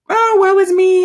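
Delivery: high, hammed-up woe-is-me wail; theatrical self-pity, mock wail. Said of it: "and I'm crying".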